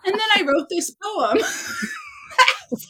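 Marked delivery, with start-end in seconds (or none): 1.4-2.9 high squealing laughter through the words; can barely get it out for laughing; real helpless laughter; laughing hard. Real, not acted. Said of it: "I'm dying".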